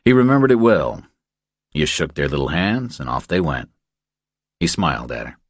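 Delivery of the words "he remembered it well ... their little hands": ordinary delivery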